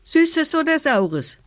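high, slow, drawn-out call; called in chorus. A narrator's reading. Children remember their rhyme for the old woman, called out high. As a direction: sing it out like children calling together. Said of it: "riefen sie alle im Chor".

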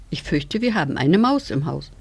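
plain reading voice

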